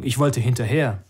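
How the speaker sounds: plain reading voice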